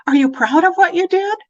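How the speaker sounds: put-on high, bright asking of a child; eager question. Shown as a demonstration. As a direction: invite her to feel proud.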